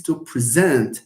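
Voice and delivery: "present" slow, stressed on the second syllable; slowly weight the end of "present". Shown as a demonstration. Teacher introducing the verb example, second-syllable stress on "present". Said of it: "stress generally falls on the second".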